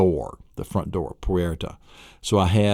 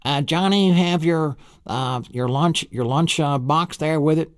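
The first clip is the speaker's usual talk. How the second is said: higher calling voice; calling big brother; 8 semitones higher than usual. Pretend. Big brother calls after Johnny, lightly higher. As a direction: call after the little brother. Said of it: "he calls out".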